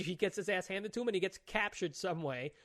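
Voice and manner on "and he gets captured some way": normal talking voice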